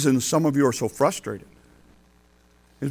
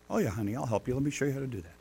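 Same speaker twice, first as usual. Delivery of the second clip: softer, tender reassurance; gentle grandpa; 3 semitones lower, 13 dB quieter, faster than usual. Retold or imitated. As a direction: soothe her like a kind grandpa. Grandpa answering granddaughter softly.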